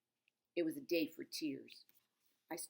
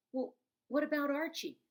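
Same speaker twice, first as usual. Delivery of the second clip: much higher, louder anxious cry; worried child; 10 semitones higher, 6 dB louder than usual. Acted. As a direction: plead for the cat. Child anxious about her cat.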